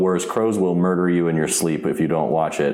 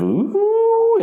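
high, drawn-out mock-impressed "ooh"; appreciative ooh; 5 dB louder than usual. Podcaster imitates Ice King hearing about a girlfriend, appreciative ooh. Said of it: "responds with an appreciative".

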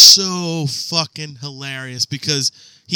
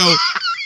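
high squealing laugh. Real, not acted. Dying laughing.

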